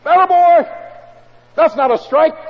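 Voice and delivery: high, loud sports-fan shout at the TV; rowdy yelling at the television. Retold or imitated. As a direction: cheer and protest like a fan at the game. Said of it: "shout and scream and yell".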